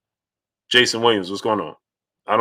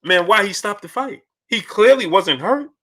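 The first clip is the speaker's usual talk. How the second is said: mocking doubters; 8 semitones higher than usual. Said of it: "people to say".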